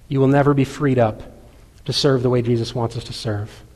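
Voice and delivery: normal talking voice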